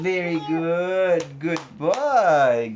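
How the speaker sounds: high, drawn-out proud praise; delighted praise. Real, not acted. Praising the child.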